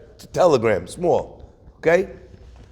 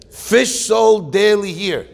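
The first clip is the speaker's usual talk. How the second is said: slow sign reading; 4 semitones higher, 5 dB louder, slower than usual. Retold or imitated.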